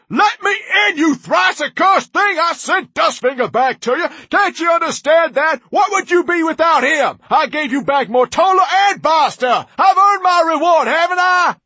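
high, loud, raging tirade; high-pitched, desperate ranting shout; furious yelling. A narrator's reading. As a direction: shout in outraged frustration at full voice. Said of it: "Orpheus had yelled so loudly".

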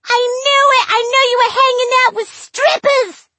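put-on high, loud shrieking girlfriend; screaming. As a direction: shriek it in a jealous fury. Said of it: "She screamed".

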